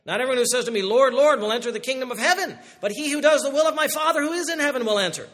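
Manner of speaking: loud, high scripture reading; preaching scripture. Acted. Preacher reading Jesus' warning to the congregation, loud and high. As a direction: proclaim it to the whole church.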